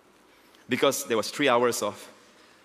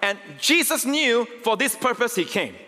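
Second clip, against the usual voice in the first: high, slow, building sermon voice; fervent preaching; 6 semitones higher than usual. Acted. Pastor pressing the cross on his congregation, fervent preaching. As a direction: preach it to the back row.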